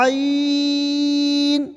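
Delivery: عین from the throat, ee held very long; long chanted throaty عین; drawn out. Shown as a demonstration. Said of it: "کھینچ کر".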